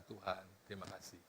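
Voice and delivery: very soft, low prayer of thanks; doa syukur lirih. Acted. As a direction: murmur it privately to God. Doa syukur yang diperagakan. (berdoa syukur dengan lirih)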